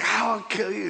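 high, punchy little-demon threat; mock demon outburst, pitched right up; angry little demon. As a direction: mimic a small, angry demon. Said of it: "He was an angry little demon".

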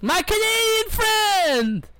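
very high, slow, drawn-out greeting from the kebab man; kebab shop owner. Retold or imitated. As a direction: call it out long and high like a beaming shopkeeper. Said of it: "he'd be like".

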